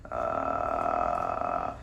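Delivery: low creaky fry sound; vocal fry. Shown as a demonstration. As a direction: drop into a low lazy crackle. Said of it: "think of it like a heart rate monitor".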